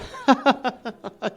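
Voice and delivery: high sarcastic chuckle; mock laugh. Stuck at the Ramblas, sarcastic laugh. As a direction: laugh at your own bad luck.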